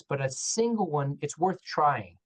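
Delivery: usual speaking voice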